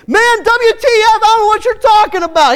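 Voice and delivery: loud, high furious outburst; cursing denial. Retold or imitated. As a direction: explode at them. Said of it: "he started cursing".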